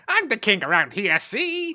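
a light mock gangster "see?" with a high squeak on the end. Retelling the film, a lightly voiced mobster. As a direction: puff up like an old movie tough guy. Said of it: "Kovac's mate is like".

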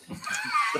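a quick voiceless laugh at himself; breathy unvoiced laugh. Real, not acted. Guest telling the host about his award, laughing. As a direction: let out a short airy laugh.

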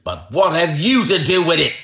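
high-pitched, forceful salesman's tirade; angry shouting. A narrator's reading. Salesman warning off a pestering man, shouting angrily. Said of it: "he shouted".

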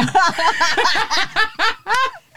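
high burst of real laughter. Real, not acted. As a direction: crack up at your own story. Laugh after the punchline.

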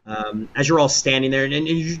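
ordinary talk, nothing marked

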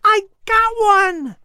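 high, slow triumphant shout; cheering. Acted. Boy Patrick cheering loud and high over his caught fish. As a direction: whoop over the catch. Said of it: "Patrick cheered".

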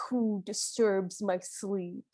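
lightly voiced thunderous spirit, slow and low; mock thunderous voice. Host imitating the Great Spirit waking up, low and slow. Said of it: "thunderous voice goes".

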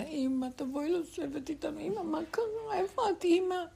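put-on high, pleading children's voices calling mother; children pleading through the door for mother; crying children. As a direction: beg like small kids at a closed door. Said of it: "בוכים לה".

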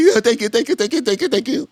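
high, rapid string of thanks; mock grateful. Guard thanking the man who cleared him, mock high gushing. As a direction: imitate gushing relief in a high voice.

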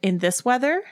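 high rising sceptical question; incredulous. A narrator's reading. Sybil answering the joke about the laundry, incredulous. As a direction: ask as if it's absurd. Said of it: "Sybil asked with raised eyebrows".

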